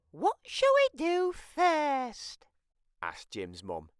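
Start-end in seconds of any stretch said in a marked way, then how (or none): 0.1-2.5 very high, slow sing-song mum voice; mum asking. Acted. Mum asking the kids what to do first, very high and slow. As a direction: draw it out sweetly.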